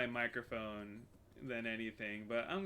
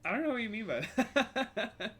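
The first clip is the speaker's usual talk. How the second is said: laughing; 9 semitones higher, 5 dB louder than usual. Real, not acted. Confused laugh at odd request.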